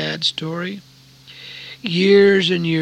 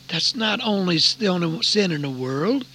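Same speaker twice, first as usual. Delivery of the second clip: usual speaking voice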